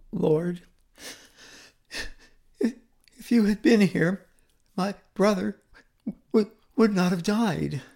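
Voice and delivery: halting, broken plea through tears; grieving words that stall and restart; tearful plea. Acted. Mary falls at Jesus' feet grieving her brother, tearful. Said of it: "Mary's tone is very different".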